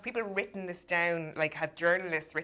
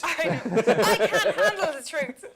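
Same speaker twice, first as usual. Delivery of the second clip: louder laughing try in a Galway accent; Irish Galway accent, giggling through it; 8 semitones higher, 8 dB louder than usual. Shown as a demonstration. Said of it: "It doesn't sound right with a Galway accent".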